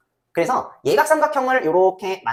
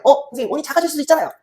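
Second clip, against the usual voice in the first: lightly voiced student, higher and quicker; student objecting; 7 semitones higher, 4 dB louder, faster than usual. Student interrupting teacher about a shrinking circle, eager objection.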